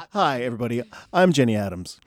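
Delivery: fast, pushy announcer plug; mock ad pitch. Host doing a mock Wendy's ad, fast and loud. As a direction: sell it like a cheesy commercial. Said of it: "mock ad pitch".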